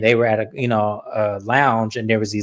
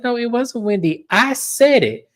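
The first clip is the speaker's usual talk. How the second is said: high-pitched retold confession; emphatic correction; 10 semitones higher than usual. Acting out Giselle owning the comment.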